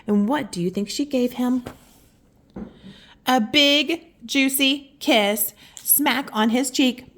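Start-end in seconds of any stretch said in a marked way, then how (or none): 3.2-7.1 slow. Acted. Building up to the kiss.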